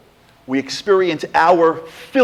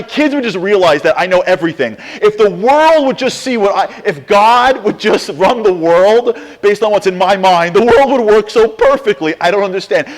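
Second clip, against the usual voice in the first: louder, higher, building indignant rant; mock exasperation; 5 semitones higher, 5 dB louder than usual. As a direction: rant like everyone who thinks they know best. Mocking self-centred wishes, rising emphasis.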